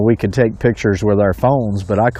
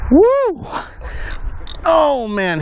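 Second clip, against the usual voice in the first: excited whoop; much slower than usual. Real, not acted. Angler thrilled that the snook still fights.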